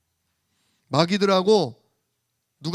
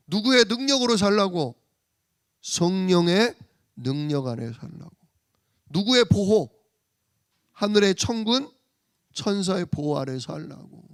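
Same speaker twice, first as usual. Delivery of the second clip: louder rising sermon cadence; preaching at full force; 4 dB louder than usual. Acted. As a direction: drive each answer home. Preaching builds.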